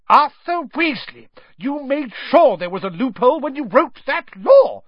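high, loud, furious scolding; shouting. A narrator's reading. Wife to husband over his loophole, furious shout. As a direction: yell his name and accuse him. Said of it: "shouted Missus Weasley".

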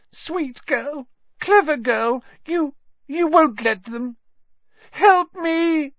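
very high, squeaky coward's plea; fawn, then cry out for help; desperate begging. A narrator's reading. Pettigrew begs Hermione for help.

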